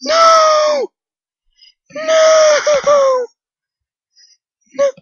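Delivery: very high shrieking no; high crying shout; high wail. Real, not acted. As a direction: shriek in defeat. Dying in a hole.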